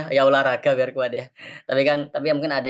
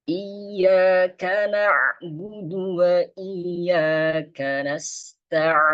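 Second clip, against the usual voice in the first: slow, higher chanted tilawah; Quran recitation; 5 semitones higher, much slower than usual. Shown as a demonstration. Teacher recites the Fatihah verse for the class. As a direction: recite slowly and calmly.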